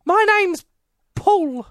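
put-on Paul voice. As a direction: play the egg thief as a squeaky goofball. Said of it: "pretend to be Paul if you want".

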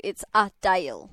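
Adele said ay-DAIL, the middle vowel like day. Shown as a demonstration. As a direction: slowly spell out Adele as ay-dail. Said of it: "written phonetically to read".

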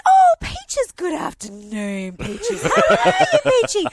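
high, swooping coo over a dog; mock gushing stranger, drawn out and loud; gushing dog greeter. Passing lady greets a stranger's dog Peaches, gushing.

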